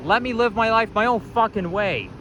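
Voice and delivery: usual speaking voice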